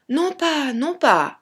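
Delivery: put-on high child's protest, easing down; Tom Pouce protesting. A narrator's reading. Tom refuses to stay on the hat, high childlike protest. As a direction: object in a small boy's high voice. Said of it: "dit Tom Pouce".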